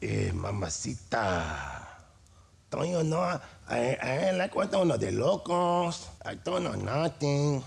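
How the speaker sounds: hammed-up Spanish accent; broken exaggerated accent. Shown as a demonstration. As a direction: ham it up, barely literate. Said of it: "more poor".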